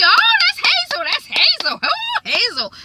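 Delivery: high, squealing mock excitement; excited squeal. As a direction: gush over Hazel in a hammed-up high voice. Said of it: "but she".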